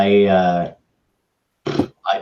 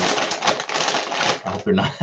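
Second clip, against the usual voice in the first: talking while crunching pretzels; words round a mouthful of pretzels; eating pretzels. Real, not acted. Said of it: "Sorry, I'm eating pretzels".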